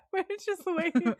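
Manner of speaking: laughing nervously while talking. Retold or imitated. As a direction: giggle through the words nervously. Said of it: "he laughs nervously".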